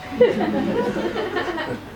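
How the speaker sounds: a quick breathy laugh; amused laugh. Real, not acted. Narrator amused by the word abaci, a quick laugh. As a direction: let a laugh slip out.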